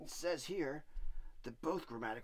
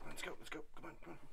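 much softer, urgent calls; hushed urging; 7 dB quieter, much faster than usual. Acted. Devil hurrying the monster over, hushed. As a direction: beckon quietly.

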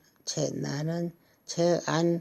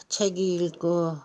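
normal talking voice

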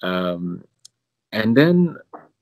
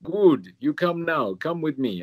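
mimicked foreign accent, pitched higher; Father Marinko's accent; 6 semitones higher, slower than usual. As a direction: imitate the parish priest's accented, broken-English summons. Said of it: "I shouldn't do the accent".

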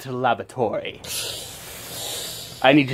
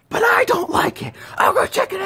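high put-on voice. Acted figure in high put-on voice.

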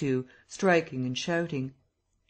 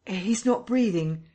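shocked; 6 semitones higher than usual. A narrator's reading. Discovering the death.

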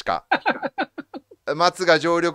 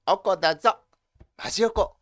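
japanese gyaru slang; 7 semitones higher than usual. Shown as a demonstration. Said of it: "ギャル語でございます".

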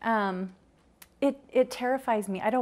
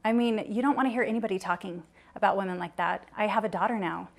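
ordinary delivery

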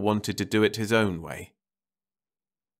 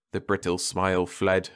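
plain reading voice

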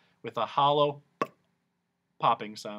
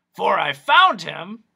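much louder, slow and grand; loud pompous doctor; Doctor Discord; 6 semitones higher, 12 dB louder, slower than usual. Acted. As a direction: declaim it. Doctor resuming.

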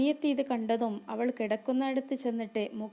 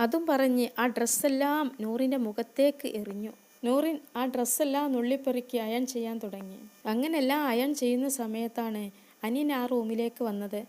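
ordinary delivery

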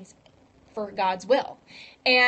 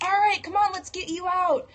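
a lightly voiced, higher rescuer; boat rescuers calling; 8 semitones higher than usual. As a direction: cheerfully urge him aboard. Boat people call to him.

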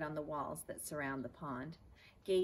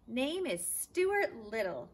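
louder, slow, higher call; call at top of lungs; 7 semitones higher, 8 dB louder, slower than usual. Acted. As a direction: shout it across the water at the top of the lungs. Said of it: "called Stuart at the top of his lungs".

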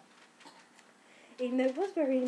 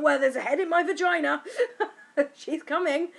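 loud, laughing, higher announcement; laughing exclaim; 7 semitones higher, 9 dB louder than usual. Retold or imitated. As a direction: blurt it out half laughing. Retold announcing baby's head.

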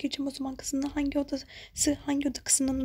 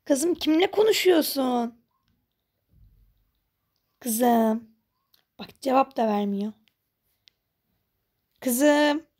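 mother calling, louder; 5 dB louder, slower than usual. Acted. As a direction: call up the stairs for daughter.

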